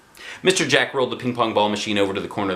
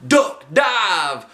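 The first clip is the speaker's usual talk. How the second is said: high, louder manic chant; crazy yell; 7 semitones higher, 3 dB louder, much slower than usual. A narrator's reading. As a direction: yell like a maniac. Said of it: "he yelled with a crazy look".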